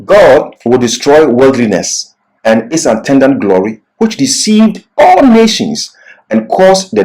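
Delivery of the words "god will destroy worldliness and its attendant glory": normal talking voice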